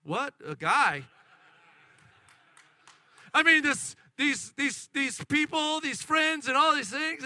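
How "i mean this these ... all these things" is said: high, loud, tumbling list; excited chatter. Acted. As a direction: imitate a daughter gushing breathlessly on. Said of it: "They're so excited".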